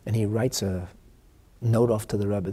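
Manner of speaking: ordinary delivery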